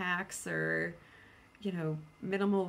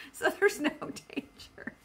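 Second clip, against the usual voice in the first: giggling high through the words; real giggles breaking the words; laughing through it; 10 semitones higher, 5 dB louder than usual. Real, not acted. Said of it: "I don't know why I'm laughing".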